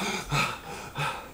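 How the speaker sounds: imitated startled panting on waking; panicky gasps. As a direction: gasp as if jolted from a dream. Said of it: "He's all, like, panicky".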